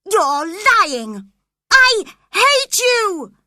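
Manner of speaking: put-on girl yelling at her cousin; angry shout. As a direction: scream it at him in rage. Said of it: "she shouted angrily".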